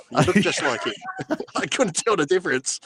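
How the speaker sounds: teasing through laughter; laughing tease. Real, not acted. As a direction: rib him, cracking up between lines. Teasing co-host, laughing as he talks.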